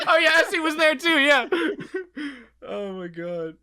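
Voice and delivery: high, loud, squealing with laughter; real laughter, half crying; laughing fit. Real, not acted. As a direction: crack up helplessly. Bursting out laughing.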